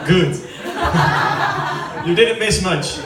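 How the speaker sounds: usual speaking voice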